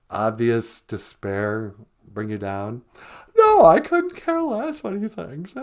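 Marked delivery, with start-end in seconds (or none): 3.4-5.5 much higher, louder, gushing caricature of Megan; mock Megan voice. Host plays Megan on the phone, high gushing squeal. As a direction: squeal it like an overexcited fan.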